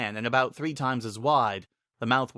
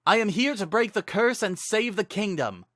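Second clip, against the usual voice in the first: hero's yell; 10 semitones higher than usual. Acted. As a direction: call out defiantly to the unseen voice. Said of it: "Link yelled".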